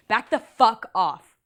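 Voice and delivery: loud, slow shout with each word punched; loud angry shout. Retold or imitated. Woman turning on a drunk man who grabbed her hair, shouting. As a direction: shout it as loud as you can. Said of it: "as loud as I possibly could".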